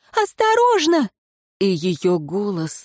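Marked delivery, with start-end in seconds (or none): 0.1-1.1 high, piercing cry of warning; frantic warning scream. A narrator's reading. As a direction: shriek a desperate warning. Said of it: "надрывно закричала Ирейн".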